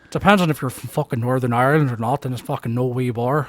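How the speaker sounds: put-on Northern Irish brogue; Northern Irish accent. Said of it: "I'm doing the much more difficult Northern Irish".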